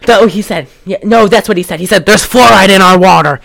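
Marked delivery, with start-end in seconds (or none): 2.1-3.4 loud mock-paranoid tirade; lunatic conspiracy theorist. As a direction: fire off conspiracies like a ranting madman. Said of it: "that's exactly how he sounded".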